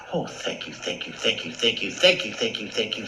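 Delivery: relieved whispered thank you. Acted. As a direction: breathe out your thanks.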